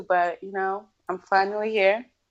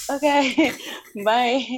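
laughing brush-off; 5 semitones higher, much slower than usual. Retold or imitated. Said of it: "you're just looking at them like".

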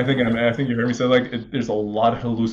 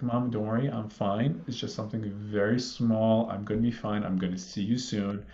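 soft, calm reassurance, softer; talking calmly; 5 dB quieter than usual. Retold or imitated. Son in ambulance soothing his crying mom, soft and calm. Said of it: "I'm just talking so calmly".

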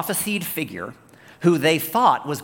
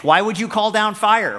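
harsh rebuke; 7 semitones higher, 4 dB louder than usual. Acted. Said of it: "His response is very harsh".

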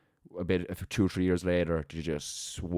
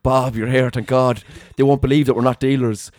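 higher and much louder, rushing with relief; relieved pleading; 5 semitones higher, 11 dB louder than usual. Retold or imitated. Boy in squad car greets his arrested friend, loud relieved pleading. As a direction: grab at your friend like a lifeline.

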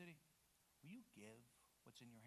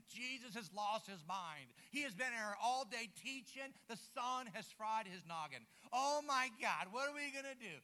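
louder, slowed alarm, building to a cry; mock panic from worried followers; panicked disciples; 4 semitones higher, 7 dB louder than usual. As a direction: disciples fretting that Jesus lost his mind. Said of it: "Could you imagine the disciples like".

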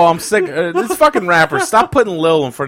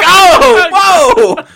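mock thrilled yell, higher and louder; excited shout; 13 semitones higher, 7 dB louder, much slower than usual. Friend mocks a Kiwi proud of his deck, excited shout.

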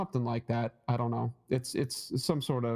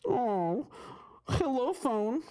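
high, playful greeting; cutesy wake-up voice; 10 semitones higher, much slower than usual. Pretend. Co-host greets the sleeping phone, in a high cutesy voice.

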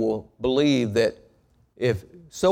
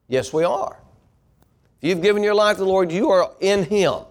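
preaching at full force; 6 semitones higher than usual. Acted. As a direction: push it out to the congregation, rising.

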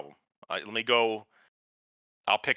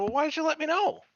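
pitched much higher, disbelieving; high incredulous; 14 semitones higher than usual. Retold or imitated. Daughter questioning dad, pitched high and disbelieving. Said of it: "And she's like".

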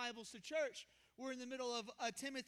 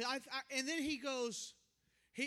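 ordinary talk

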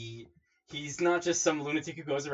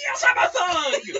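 slow, high shrieked boast through a laugh; high shrieking yell, laughing; 10 dB louder, slower than usual. Retold or imitated. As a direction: scream it proudly, cracking up. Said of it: "And she goes".

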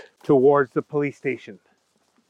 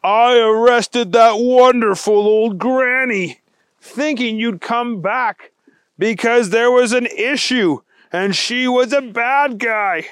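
higher, slow and emphatic; dismayed special agent; 7 semitones higher, 3 dB louder, slower than usual. Acted. Wendell realizing he arrested granny, dismayed. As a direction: confess it with storybook horror. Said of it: "he felt sick".

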